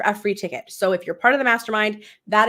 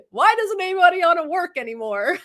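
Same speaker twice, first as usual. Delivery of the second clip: lightly mocking Kardashian whine, higher pitch; Kardashian moment; 9 semitones higher than usual. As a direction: toss off a half-joking celebrity complaint. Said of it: "a Kardashian moment".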